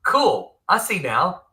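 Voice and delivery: retold aha moment, voice lifting; sudden realisation. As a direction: act out a light bulb going on. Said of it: "like a light bulb just went off".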